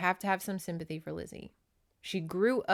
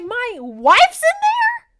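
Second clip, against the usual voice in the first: high, very loud disbelief; shocked shout; 15 dB louder, much slower than usual. Retold or imitated. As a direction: draw it out in a hammed-up yell. Acting husband's shocked discovery.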